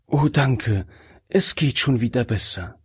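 ordinary delivery, nothing marked

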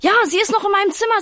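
high, loud, rapid exclamation; rushed, high-pitched storybook cry; excited outburst. A narrator's reading. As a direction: blurt out the discovery at top speed. Boy spots the mosquito, acted.

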